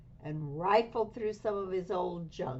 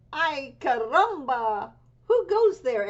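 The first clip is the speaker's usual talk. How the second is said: much higher, louder alarmed call; startled challenge; 8 semitones higher, 10 dB louder than usual. Acted. Kitten meets a band of Chihuahuas, startled loud challenge. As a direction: shout out at the strangers in the dust.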